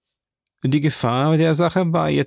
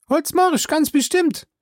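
Jupiter's voice. Acted. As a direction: pitch him well up and call out. Jupiter answers.

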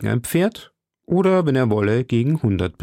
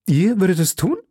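hesitant question, pitched high; unsure, tempted question in a higher voice; irresolute; 9 semitones higher, 3 dB louder than usual. A narrator's reading. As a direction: waver as you ask, voice going up. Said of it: "fragte D'Artagnan unschlüssig".

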